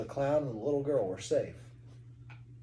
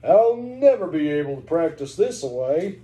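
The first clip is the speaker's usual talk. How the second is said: a hint of a drawling cowboy, louder; 8 dB louder than usual. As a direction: play a rope-swinging cowboy, lightly. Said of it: "Dab Dab's best cowboy voice".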